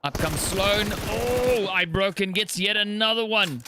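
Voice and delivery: caster hyped by a big play; excited. Real, not acted. Caster reacts to a double kill.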